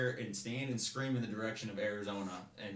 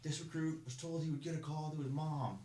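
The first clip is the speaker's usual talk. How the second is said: whiny recruit; 8 semitones higher than usual. Retold or imitated. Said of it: "And he's like".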